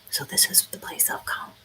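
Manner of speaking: soft library whisper. Real, not acted. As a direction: whisper so nobody hears. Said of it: "I have to whisper".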